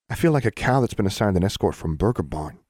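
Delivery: ordinary delivery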